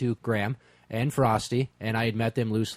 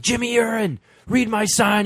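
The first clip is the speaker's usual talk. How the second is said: higher, louder call to the stage; 10 semitones higher, 6 dB louder than usual. Retold or imitated. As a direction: shout up at the singer. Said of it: "and I'm like".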